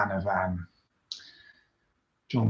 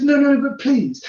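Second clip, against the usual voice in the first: much higher, louder mock pleading; mock pleading rider, much higher; 5 dB louder than usual. Rider begging the cyclist to pass, much higher. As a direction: imitate her polite begging. Retold rider insisting politely.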